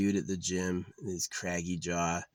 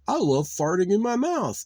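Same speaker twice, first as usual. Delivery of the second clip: much higher, louder goofy voice; high loud cartoonish mockery; silly mock voice; 13 semitones higher, 8 dB louder than usual. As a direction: squeak it like nonsense. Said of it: "be like".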